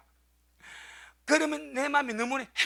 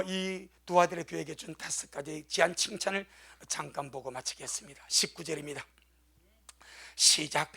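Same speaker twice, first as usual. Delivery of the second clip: normal talking voice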